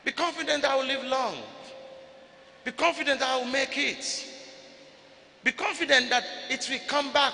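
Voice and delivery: ordinary talk, nothing marked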